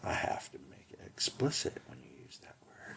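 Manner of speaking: hushed unvoiced aside; whispering. Real, not acted. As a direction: whisper it behind your hand. Aside about the podcast.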